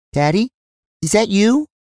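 put-on high-pitched little girl voice; young daughter. Young daughter calling to her dad at night, high little-girl voice.

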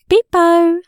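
high, loud sing-out; playful peekaboo call. A narrator's reading. Narrator plays peekaboo with the baby, playful high call.

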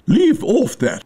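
high, mildly screamed command; shrill royal scolding. A narrator's reading. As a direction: screech it as an annoyed queen. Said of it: "screamed the Queen".

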